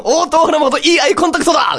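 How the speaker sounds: sports commentator. Pretend. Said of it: "みたいなさ".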